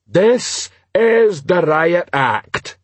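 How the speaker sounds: very slow, high troll announcement; troll proclaiming. Acted. Troll reading the Riot Act to rioters, slow proclamation. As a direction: proclaim it ponderously, word by word.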